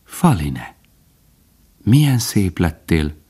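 slow, lightly voiced young stag in awe; enraptured. A narrator's reading. Bambi gazing at Faline, slow and enraptured. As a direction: breathe it out slowly, enchanted. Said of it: "aztán elragadtatva mondta".

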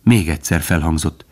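plain reading voice

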